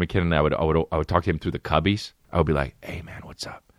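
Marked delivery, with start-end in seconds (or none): 2.8-3.6 imitating a kid whispering at nap time; acted whisper. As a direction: whisper it secretly to a classmate. Kids whispering through the cubbies.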